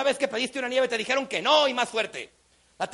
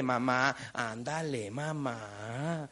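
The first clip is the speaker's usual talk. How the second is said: low, soft, drawn-out whining; pleading little boy; 11 semitones lower, 8 dB quieter, slower than usual. Retold or imitated. Boy begs his mom for ice cream, low wheedling. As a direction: drag out every word, begging quietly. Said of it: "va con su madre y le dice".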